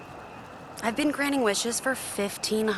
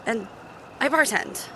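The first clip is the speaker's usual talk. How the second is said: usual speaking voice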